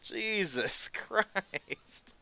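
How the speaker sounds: slow, high Jesus Christ broken by a laugh; laughing disbelief. Real, not acted. Scoffing at publishing staff who cried over a book, laughing slowly.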